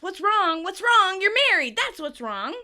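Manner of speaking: loud, high exasperated outburst; Chandler shouting. Retold or imitated. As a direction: shout it back in disbelief, easing at the end. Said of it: "Chandler shouts".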